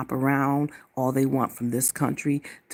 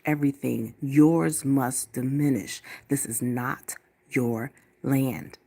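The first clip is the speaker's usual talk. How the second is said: slow solemn declaration; slower than usual. Acted. Slow defiant declaration of land.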